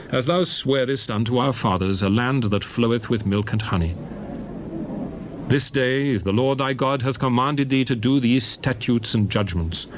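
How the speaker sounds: usual speaking voice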